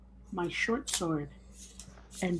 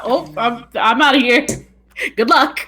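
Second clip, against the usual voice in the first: loud, high cheery bail-out; gleeful exit; 5 semitones higher, 12 dB louder than usual. Acted. Player bailing out of melee, loud and cheery. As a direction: shout a cheeky goodbye while fleeing. Player voices fleeing character.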